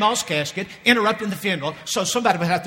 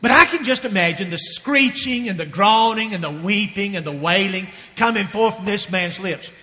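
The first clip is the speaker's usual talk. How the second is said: ordinary delivery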